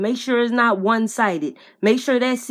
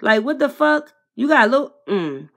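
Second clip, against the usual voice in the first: ordinary talk, nothing marked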